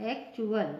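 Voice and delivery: एक्चुअल repeated as "ek-chu-al"; T read as CH. Shown as a demonstration. Teacher repeating the word for learners, एक्चुअल with "ch". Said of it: "इसका pronunciation".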